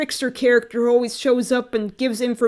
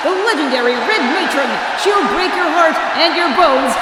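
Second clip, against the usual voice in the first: loud, higher hype shout; ring announcer; 4 semitones higher, 5 dB louder than usual. Acted. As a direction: yell it to a packed arena. Reads wrestling announcement.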